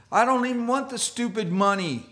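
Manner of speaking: upset Judas. Retold or imitated. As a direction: fling the words out in disgust. Voicing Judas's remorse.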